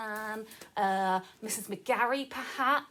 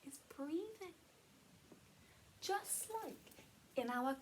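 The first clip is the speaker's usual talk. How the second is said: soft, hushed and soothing; calming; 3 semitones higher, 7 dB quieter than usual. Real, not acted. Teacher telling children breathing helps them feel calmer, soft and soothing. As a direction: gently settle them with a quiet voice.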